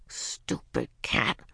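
low, slow, hushed grumble; soft disgusted mutter, nearly whispered; grumbling. A narrator's reading. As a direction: drag it out under your breath. Ramona grumbles at the cat.

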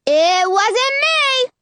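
put-on very high, drawn-out little boy voice; squeaky kid protesting his innocence; squeaky yell. A narrator's reading. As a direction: yell back in a squeaky child's voice. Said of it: "a squeaky voice yells back".

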